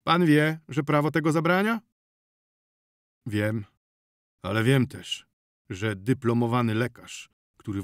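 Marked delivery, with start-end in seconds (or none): 0.0-1.8 raised, pointed question; louder character voice. Acted. (głośniejszy głos postaci)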